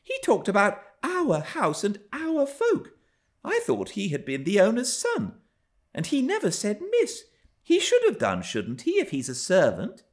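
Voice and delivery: put-on high, girlish complaining; tiresome young girl. A narrator's reading. Young Cathy appealing to her nurse about Hareton, high childish voice.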